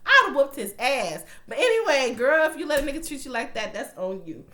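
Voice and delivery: loud, fired-up scolding; loud rant. Real, not acted. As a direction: call the viewers out loudly. Ranting at the viewers.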